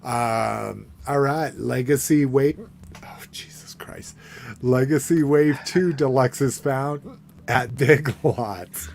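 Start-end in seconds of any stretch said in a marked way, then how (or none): 3.0-4.1 whispered oh Jesus Christ under breath; whispered exclamation. Real, not acted. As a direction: mutter it in a whisper. Reacts to price, under breath.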